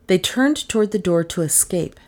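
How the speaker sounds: ordinary delivery, nothing marked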